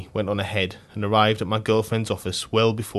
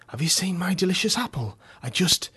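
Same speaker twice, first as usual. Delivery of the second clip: high, strange voice for the crawling man; odd high man; 9 semitones higher than usual. A narrator's reading. Crawling man asking about his apple, high odd voice. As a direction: play the crawling onion-seeker, pitched way up.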